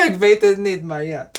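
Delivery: giggling through the words; laughing. Real, not acted. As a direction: laugh while saying it. Laughing at own Dutch.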